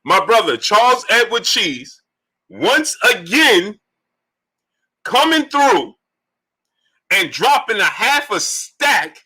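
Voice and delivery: high, slow, drawn-out announcer call; hype donation announcement. Acted. Host announcing five hundred in the super chat, drawn-out hype. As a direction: stretch each phrase, holding "hundred" long like a ring announcer.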